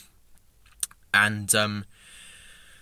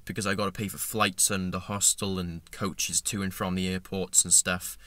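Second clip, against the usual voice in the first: ordinary talk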